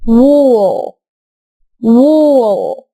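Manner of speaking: وو said as a long rounded oo; drawn-out oo sound. Shown as a demonstration. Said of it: "فأقول". (صوت وو طويل مدور)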